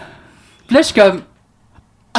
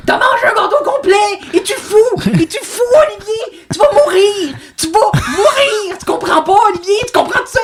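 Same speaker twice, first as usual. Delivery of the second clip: mother losing it. Retold or imitated. Mother screams at son who ate a whole cake. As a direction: scold him like he might die. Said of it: "elle me pète une coche".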